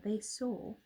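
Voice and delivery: ordinary delivery, nothing marked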